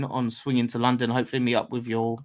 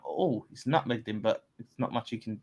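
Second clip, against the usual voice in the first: ordinary talk, nothing marked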